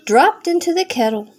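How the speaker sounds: ordinary delivery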